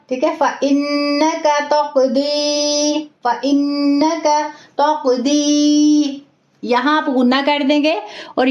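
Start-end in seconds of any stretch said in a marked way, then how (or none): 0.4-6.2 slow, drawn-out recitation with nasal ghunna on the doubled n; Quran recitation demo. Shown as a demonstration. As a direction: recite फ़इन्नका तक़दी slowly, holding the नन्न with ghunna. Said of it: "तो यहाँ आप पढ़ेंगे फ़इन्नका". (फ़इन्नका को गुन्ना के साथ धीरे-धीरे खींचकर पढ़ना)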